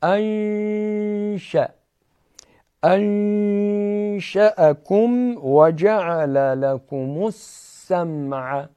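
انشأکم with the noon hidden and a nasal hum, drawn out slowly; nasal ikhfa recital. Shown as a demonstration. (انشأکم با اخفا و غنه)

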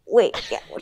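hushed aside; whispering. Real, not acted. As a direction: drop to a whisper.

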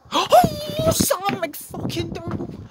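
soaring, slowed-down roar of joy; ecstatic goal scream. Real, not acted. Fan in his garden screaming the goalscorer's name in ecstasy. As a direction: scream the scorer's name to the sky.

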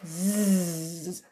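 low, long "Z" as zzzzz; buzzed Z sound. Shown as a demonstration. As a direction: sustain "Z" as a voiced buzz. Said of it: "Le S chante". (« Z » chanté : zzzzz grave)

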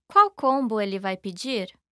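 normal talking voice

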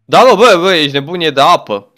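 high, loud excited outburst; excited shout. Real, not acted. Player realizing the dinosaur lives in water, excited shout. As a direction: shout the discovery at your friend.

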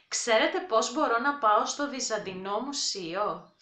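Ξέρετε πώς μπορώ να πάω στο Βυζαντινό Μουσείο? at brisk native speed; quick everyday pace; fast natural take. Shown as a demonstration.

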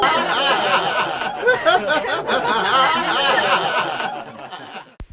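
long loud burst of laughter; big cackle that trails off. Real, not acted. Laughs at the clip's punchline.

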